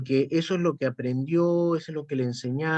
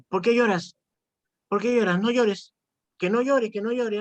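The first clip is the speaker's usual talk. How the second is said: higher, insistent mock scolding; scolding dad; 7 semitones higher than usual. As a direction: repeat the reproach like an impatient father. Father in the club scolding. (imitando a un papá que regaña a su niño, más agudo)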